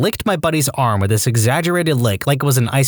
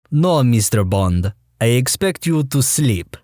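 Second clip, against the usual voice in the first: slow, menacing villain voice; slower than usual. Retold or imitated. Villain reply in Bond scene.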